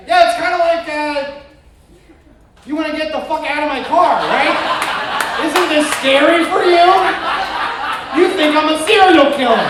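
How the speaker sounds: high, loud panicky babble; nervous pleading. Pretend. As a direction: beg the passenger not to be scared. Nervous driver's imagined speech.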